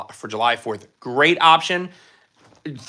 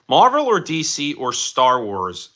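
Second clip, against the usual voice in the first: normal talking voice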